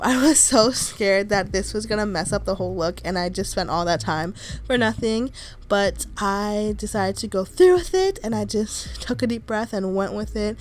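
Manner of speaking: ordinary delivery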